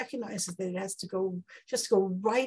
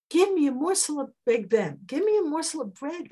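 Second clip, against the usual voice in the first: higher, louder begging voice; beggar's plea; 7 semitones higher, 5 dB louder than usual. Acted. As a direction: beg at the door for bread.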